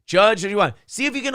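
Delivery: high teasing jeer; mocking taunt. Real, not acted. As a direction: tease him in a singsong. Taunting Jackson on video.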